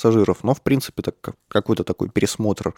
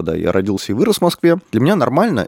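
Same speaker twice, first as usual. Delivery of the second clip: ordinary talk, nothing marked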